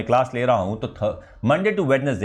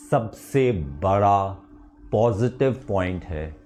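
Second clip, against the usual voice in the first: lower, slow, dramatic pep talk; motivational speech; 4 semitones lower, slower than usual. Acted. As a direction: hand students a closing rallying cry, slowly. (धीमा, गंभीर प्रेरक भाषण)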